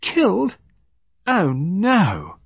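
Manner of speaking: high-pitched disbelief; lady's high, disbelieving voice; Lady Chevenix Gore. A narrator's reading. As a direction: start very high, then gently deny it. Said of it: "Lady Chevenix Gore looked up and said".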